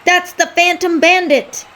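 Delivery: Wolf and Snake yell out who the fighter is. Acted. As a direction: shout the discovery. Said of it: "at the same time yelled out".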